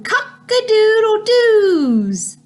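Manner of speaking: high, long crow sliding down at the end; high storybook cock-a-doodle-doo; rooster crow. A narrator's reading. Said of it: "Here is a rooster".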